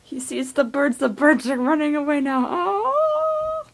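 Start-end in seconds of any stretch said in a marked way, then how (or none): 2.5-3.7 surprised. Real, not acted. Birds fly off.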